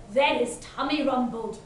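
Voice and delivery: plain reading voice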